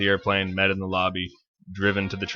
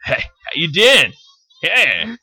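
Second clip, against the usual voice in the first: high, loud, slow flirty New York pickup line; Joey impression; 8 semitones higher, 6 dB louder than usual. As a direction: lay on the Joey charm, stretched out. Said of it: "the, the New York?".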